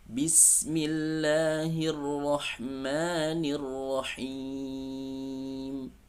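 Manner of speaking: slow, high chanted recitation; Quran recitation. Shown as a demonstration. Teacher recites the verse for students.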